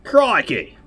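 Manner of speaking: Aussie "Crikey!" thrown out, hammed-up; Australian accent. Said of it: "no, that'd be Australian".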